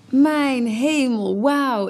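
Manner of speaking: high, loud, drawn-out amazement; wowed and wishing you could too; awed admiration. Acted. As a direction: marvel out loud, stretching the words. Acted thought admiring younger women.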